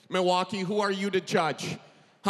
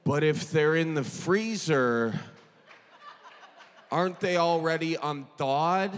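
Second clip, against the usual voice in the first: lower dopey friend voice; 5 semitones lower than usual. Retold or imitated. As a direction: play the pedantic friend, lower. Friend's pedantic reply voiced.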